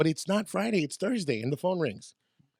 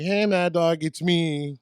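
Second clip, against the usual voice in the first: loud, drawn-out cheery hello; eager phone greeting; 4 dB louder, much slower than usual. Retold or imitated. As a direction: announce yourself brightly as a hopeful kid caller. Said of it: "I would call and be like".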